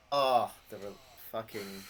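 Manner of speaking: usual speaking voice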